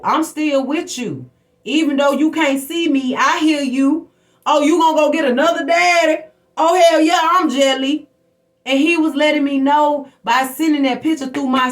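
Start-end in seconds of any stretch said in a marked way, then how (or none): 0.0-8.1 louder, teasing jealous father from beyond; jealous dead daddy. Retold or imitated. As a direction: taunt her like a jealous ghost dad, rising louder. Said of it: "He had to let me know".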